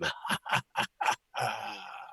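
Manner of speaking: soft staccato evil chuckle; sinister trailing cackle; villain laugh. Acted. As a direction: chuckle darkly like a mastermind. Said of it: "as if he was a Bond villain".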